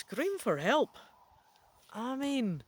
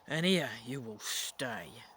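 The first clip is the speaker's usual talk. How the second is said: put-on a cruel old voice croaking close to the ear; 8 semitones lower, slower than usual. A narrator's reading. Ghost of King Richard croaks to the trapped nephew, cruel and menacing. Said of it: "A cruel voice croaks".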